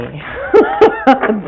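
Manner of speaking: a burst of real laughter. Real, not acted. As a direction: laugh out loud at your own joke. Laughs at own quip.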